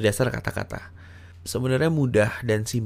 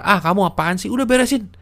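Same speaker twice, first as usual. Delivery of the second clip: sharp, higher, louder snapping at her; irritated nagging at a girlfriend; annoyed scolding; 9 semitones higher, 4 dB louder, faster than usual. Shown as a demonstration. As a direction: scold her impatiently for the mess. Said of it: "daripada kamu marah-marah".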